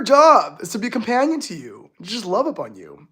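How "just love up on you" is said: normal talking voice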